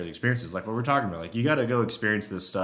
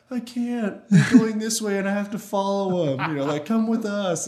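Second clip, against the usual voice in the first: much higher, whining through laughter; whiny bull voice; 10 semitones higher, 4 dB louder than usual. Retold or imitated. Bull tells the hunter he must follow, laughing whine. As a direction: voice the torn bull, half laughing. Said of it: "he was super talkative".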